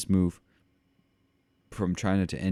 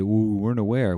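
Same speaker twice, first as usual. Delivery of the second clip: raised, louder mocking whine; higher, louder parody of feigned ignorance; mock excuse; 5 semitones higher, 8 dB louder than usual. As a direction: imitate a company protesting its innocence. Mimics companies' excuse.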